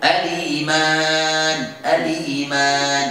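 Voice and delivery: very slow "al-iman" as a-lii-maan, level chant; joined recitation. Shown as a demonstration. Teacher shows students the reading, slow level chant. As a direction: draw out "al-iman" without a glottal stop. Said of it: "Berarti baca gimana?".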